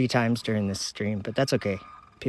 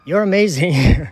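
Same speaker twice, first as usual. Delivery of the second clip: louder, higher, slow and emphatic; cheered affirmation; 4 semitones higher, 7 dB louder than usual. Shown as a demonstration. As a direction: declare it proudly on request. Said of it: "tell yourself that you're amazing".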